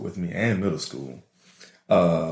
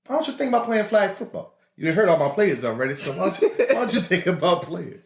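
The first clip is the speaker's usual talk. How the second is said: high-pitched coach making a plea; football coach; 9 semitones higher than usual. Retold or imitated. P E coach nudging a student toward flag football, high imitated voice. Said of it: "the coach of the flag football team".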